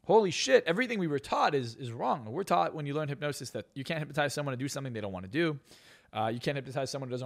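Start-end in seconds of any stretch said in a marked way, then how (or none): 0.1-2.3 loud burst of realization; excited exclamation. Real, not acted. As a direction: blurt it out, amazed. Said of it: "sudden realization, loud".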